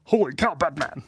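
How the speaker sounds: high mock-amazed catchphrase, dropping on the last word; mock exclamation. Streamer riffing on the drawing's title, mock exclamation. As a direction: exclaim it like a comic sidekick.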